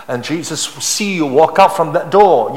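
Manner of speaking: ordinary delivery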